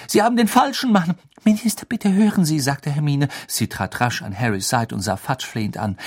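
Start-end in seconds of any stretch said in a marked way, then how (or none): 0.1-2.6 high, loud, hurried protest; desperate, high-pitched appeal; urgent pleading. A narrator's reading. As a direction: beg insistently to be heard. Girl pleads with the Minister.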